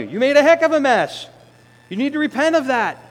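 high, loud scolding; stern rebuke. Acted. Holy Spirit scolding the sinner, high and loud. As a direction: tell them off firmly.

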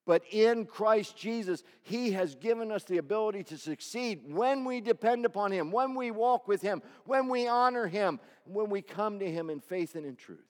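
building preacher's cadence; rising preaching. Acted. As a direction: lift the congregation up.

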